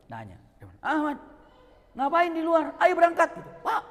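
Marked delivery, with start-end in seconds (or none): 0.8-3.4 loud, high call to the driver. Retold or imitated. Calling driver back to car. 3.6-3.8 urgent call. Retold or imitated. Urging sheikh to look.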